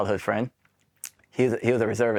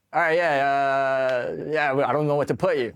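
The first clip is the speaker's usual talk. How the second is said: clueless officer; 5 semitones higher than usual. Retold or imitated. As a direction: imitate an officer with no idea where to put him. Officer's clueless check-in reply.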